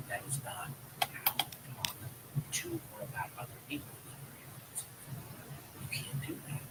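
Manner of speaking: hushed, near-whispered reprimand; gentle parental lecture. Acted. Dad mimes correcting his daughter, hushed gentle lecture. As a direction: speak softly, as if reasoning with a child.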